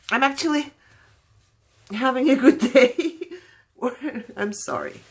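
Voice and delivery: high, choked-up voice, fading into an apology; trying to talk through tears; real tearful. Real, not acted. Apologising to viewers for crying, tearful and high. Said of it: "I'm just crying all the time".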